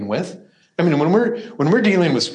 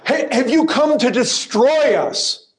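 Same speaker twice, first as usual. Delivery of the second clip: higher and louder, crying out in dread; terrified demon cry; 10 semitones higher, 4 dB louder than usual. Acted. Demon cries out to Jesus in sheer dread. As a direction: plead in panic as if facing doom. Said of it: "He says".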